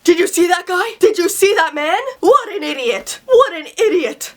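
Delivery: mock outraged grandpa repeating himself; imitating angry grandpa. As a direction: huff it out like a fed-up grandpa. Grandpa fuming at litterer.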